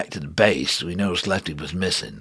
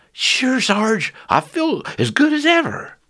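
put-on young soldier voice; 4 dB louder than usual. Lefty voiced.

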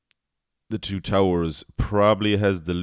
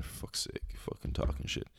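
muttered; 4 semitones lower, 12 dB quieter than usual. Retold or imitated. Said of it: "It's like".

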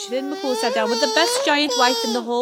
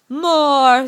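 put-on loud, higher baby demand; 5 semitones higher, 9 dB louder than usual. A narrator's reading. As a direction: call out greedily for food. Baby demands another loaf.

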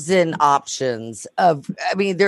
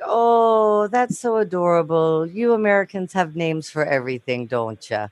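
Irish lilt gone a little Fargo-ish; off-target Irish accent, sweetly condescending; bad Irish accent. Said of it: "My Irish accent is way off".

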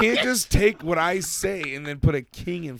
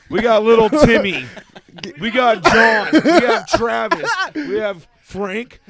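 louder, higher host shout-out; hyped roll call; 6 semitones higher, 8 dB louder than usual. Pretend. Host hyping up the crew, loud announcer shout-outs. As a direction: pump up each name like a ring announcer.

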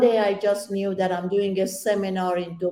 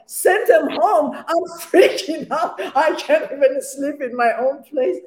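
incredulous panic; 6 semitones higher than usual. Retold or imitated. Answering her friend on the phone, panicked disbelief. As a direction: toss the advice back in alarm.

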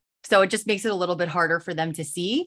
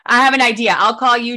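excited idea; 6 semitones higher, 11 dB louder than usual. Real, not acted. Sudden idea fixing tech trouble.

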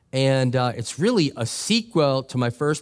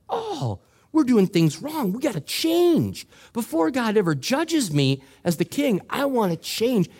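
much higher, urgent king's voice; high hammed-up alarmed king; Josiah's alarm; 9 semitones higher than usual. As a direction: cry out like a king realizing his wrong.

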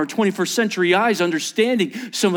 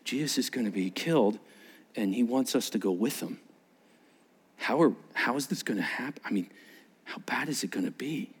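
low, much softer, halting; anxious private thought; 6 semitones lower, 9 dB quieter than usual. Retold or imitated. Disciples worrying privately about what awaits, hushed. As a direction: murmur it haltingly, sinking to a whisper. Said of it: "in the back of their minds".

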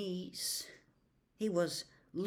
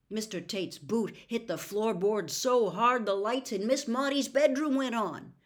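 plain reading voice

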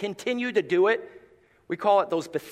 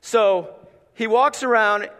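normal talking voice

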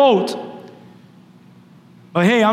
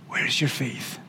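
a soft, unvoiced whisper; whispered question; 8 semitones lower, 11 dB quieter than usual. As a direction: breathe the words out, barely voiced. Jesus' quiet question in storm.

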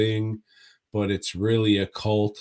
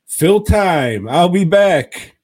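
urgent; 9 semitones higher, 12 dB louder, slower than usual. Real, not acted. Rushing off to the toilet.

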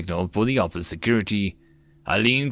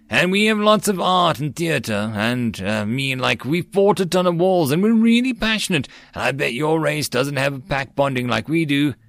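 eager boasting; 4 semitones higher than usual. Acted. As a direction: brag with building excitement. Human boasting eagerly about Earth.

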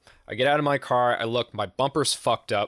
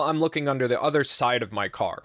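ordinary talk

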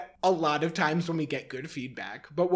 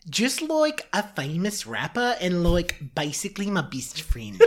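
put-on Kiwi boy's accent, casual; New Zealand accent; 2 semitones higher, slower than usual. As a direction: lay on the New Zealand vowels, offhand. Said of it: "he can do a New Zealand accent".

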